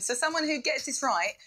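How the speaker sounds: usual speaking voice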